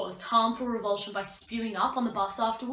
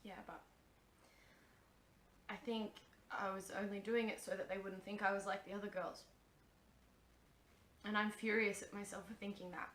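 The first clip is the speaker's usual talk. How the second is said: quiet monotone; 2 semitones lower, 10 dB quieter than usual. Acted.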